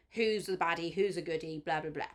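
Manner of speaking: ordinary talk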